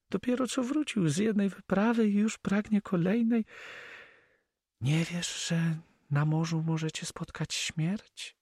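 high, agitated female character voice; upset Flemish girl. Acted. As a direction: reproach him in a high lady's voice. (wzburzony, wysoki głos dziewczyny)

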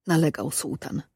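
ordinary delivery, nothing marked

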